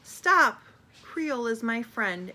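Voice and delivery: loud Stop fading to firm speech; loud high Stop, then steadier defence of a friend; clear shout. Acted. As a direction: shout after them then calm down. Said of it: "shouting in a very clear voice".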